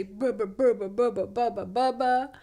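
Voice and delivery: playful, nonsense grumbling; mock grumpy muttering. Venting on a walk to let grumpiness out, mock grumpy. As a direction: babble a string of grumpy nonsense. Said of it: "talk out loud in that grumpiness".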